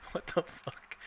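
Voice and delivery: breathless, soft what the fuck; whispered disbelief. Real, not acted. Friend reacts to a weird power, whispered disbelief. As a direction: whisper it, stunned by the absurdity.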